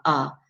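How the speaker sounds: are said in full, strong vowel; strong form are. Shown as a demonstration. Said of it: "Sepatutnya are macam ni".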